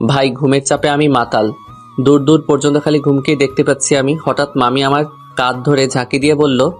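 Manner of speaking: ordinary delivery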